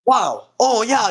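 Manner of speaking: ordinary talk, nothing marked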